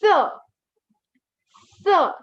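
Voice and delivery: normal talking voice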